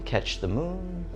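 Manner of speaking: usual speaking voice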